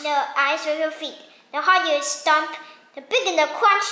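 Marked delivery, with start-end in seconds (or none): none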